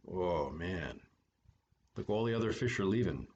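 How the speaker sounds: usual speaking voice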